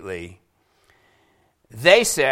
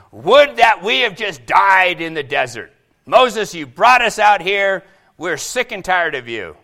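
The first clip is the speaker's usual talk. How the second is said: loud, raised-voice complaint; angry grumbling; 3 semitones higher, 9 dB louder than usual. Acted. Israelites complaining to Moses in the desert, angry grumbling. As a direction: protest loudly, fed up with the leader. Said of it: "they grumbled against Moses and Aaron".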